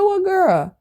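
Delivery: high-pitched baffled question; retold incredulity. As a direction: act out being caught off guard. Retold puzzled reaction to accusation.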